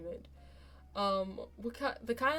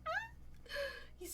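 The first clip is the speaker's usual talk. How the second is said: high gushing squeal; high, gushing coo; 6 dB quieter, slower than usual. Real, not acted. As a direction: coo over him in a raised voice. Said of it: "I'm gushing".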